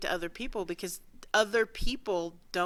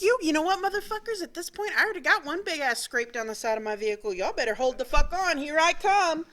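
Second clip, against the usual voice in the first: high, loud mock threat; defiant road rage rant; 8 semitones higher, 4 dB louder than usual. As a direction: yell it at the other drivers. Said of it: "I'm like".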